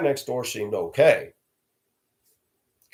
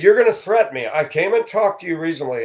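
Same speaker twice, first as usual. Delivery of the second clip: higher, louder indignant reply; indignant retort; 6 semitones higher, 4 dB louder than usual. Retold or imitated. Answering the neighbor who threatened him, indignant and loud. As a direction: push back hard.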